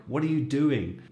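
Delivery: sharp, suspicious question; accusing tone. Shown as a demonstration. Teacher showing the annoyed English version. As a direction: demand to know, a touch annoyed. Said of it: "you can tell by the change in my tone".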